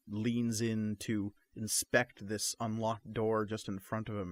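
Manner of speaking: ordinary talk, nothing marked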